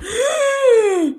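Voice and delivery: huge high loud gasp; loud high startled gasp; shocked gasp. Retold or imitated. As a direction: gasp as the news lands. Shock at album announcement.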